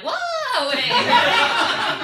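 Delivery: floaty, high mock-feminine whoa; high-pitched woozy whoa. Patient high on painkillers, floaty high whoa. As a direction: drift it out like you're high.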